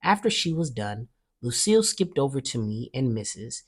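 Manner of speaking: ordinary delivery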